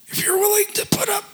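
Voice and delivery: mock of the pastor's broken voice; ruined voice imitation. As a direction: rasp it out like damaged vocal cords. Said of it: "with my voice".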